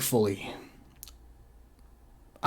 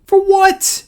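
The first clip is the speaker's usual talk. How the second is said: pitched-up outraged demand. Real, not acted. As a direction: push it out again, high and outraged. Repeats, louder.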